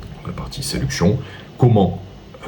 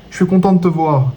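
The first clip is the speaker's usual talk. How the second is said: high-pitched gushing greeting; pitched-up, sing-out delivery; tonality demo, high; 12 semitones higher than usual. Shown as a demonstration. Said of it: "Ta tonalité ... si je te dis".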